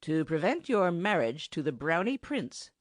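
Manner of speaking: plain reading voice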